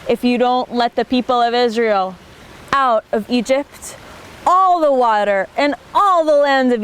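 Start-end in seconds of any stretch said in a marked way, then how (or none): none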